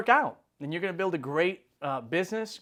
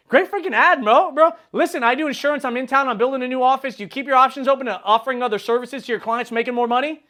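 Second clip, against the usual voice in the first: shouted, much higher bro greeting; loud hyped greeting; 9 semitones higher, 8 dB louder than usual. Retold or imitated. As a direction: hail him loudly like a long-lost buddy. Hyped pitch to realtor.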